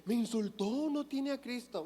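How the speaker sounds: imitando con burla, más agudo; sorpresa burlona. Retold or imitated. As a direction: remeda al que se escandaliza de lo obvio. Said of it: "de decir". (el predicador imita a un creyente escandalizado, en tono burlón y agudo)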